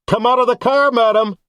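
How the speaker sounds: police bullhorn ordering the woman out. A narrator's reading. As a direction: bark it through a bullhorn.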